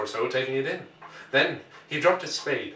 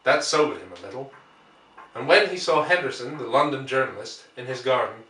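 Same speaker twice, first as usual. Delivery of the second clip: ordinary delivery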